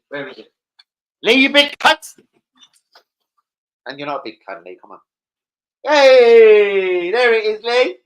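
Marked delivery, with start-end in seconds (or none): 1.2-2.2 high, loud teasing shout; mock insult yell. Real, not acted. Streamer yelling a teasing insult at co-host Lee. 5.8-8.0 high, loud hey; excited greeting. Real, not acted. Streamer loudly cheering as Lee shows up.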